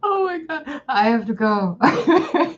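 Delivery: words squeezed out between giggles; helpless laughing fit; can't stop laughing. Real, not acted. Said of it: "I can't stop laughing".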